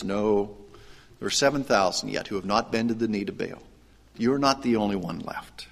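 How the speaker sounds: soft and hushed, sinking into a whisper; still small voice. Acted. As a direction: speak gently, almost a whisper. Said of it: "in this still small voice".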